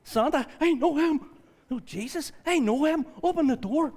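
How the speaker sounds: put-on childlike overexcited shriek; Elf impression. Believer gushing like Elf at Santa, high squeal. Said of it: "you get a wee bit like Elf".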